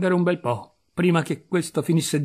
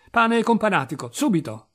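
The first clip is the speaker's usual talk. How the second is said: higher-pitched, emphatic command; raised, urgent order; brisk order; 6 semitones higher, 3 dB louder than usual. Acted. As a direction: call for food at once. Ordering food for the prisoner.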